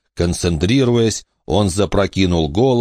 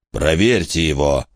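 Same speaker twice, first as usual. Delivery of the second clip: hoarse rasp; 2 semitones lower than usual. A narrator's reading. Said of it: "прохрипел Крипсин".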